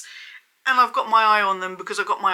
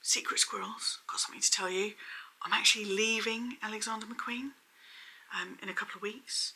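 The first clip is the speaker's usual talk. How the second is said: whisper; 8 dB quieter than usual. Retold or imitated. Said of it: "She's like".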